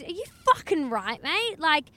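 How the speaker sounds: mock outraged bloke; retold angry telling-off. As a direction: tell off a careless stranger, mildly hammed-up. Acted angry stranger telling off.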